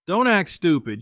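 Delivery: ordinary delivery, nothing marked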